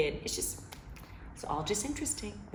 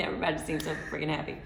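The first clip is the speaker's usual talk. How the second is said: ordinary talk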